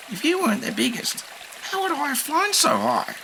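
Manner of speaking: very high kind voice; reassuring bird. A narrator's reading. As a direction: lift his spirits. Shishi voice.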